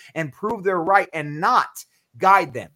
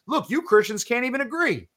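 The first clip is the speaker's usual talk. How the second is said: higher-pitched scornful quote; mock scoffing; 6 semitones higher than usual. Non-believers remarking on a livestream, mock scoffing. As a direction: voice a critic calling them out.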